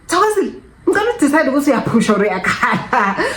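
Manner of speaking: high nurse voice, laughing through it; exasperated nurse. Retold or imitated. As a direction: imitate the fed-up nurse while laughing. Nurse demanding she choose.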